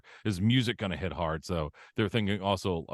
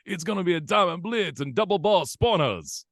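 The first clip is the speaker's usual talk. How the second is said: high, far-off DJ hype voice; 10 semitones higher than usual. Shown as a demonstration. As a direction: belt it like the game DJ. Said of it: "I actually said it better that time".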